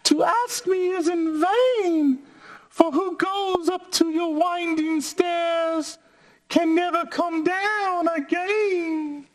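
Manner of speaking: very high shouting fly; tiny squeaky voice crying out. Acted. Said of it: "said the spider to the fly".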